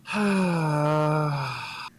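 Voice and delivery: long, drawn-out breathy sigh; exasperated sigh. Acted. Aaron pinching the bridge of his nose, exasperated sigh. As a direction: let out one long weary exhale. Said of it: "pinching his, uh, his nose".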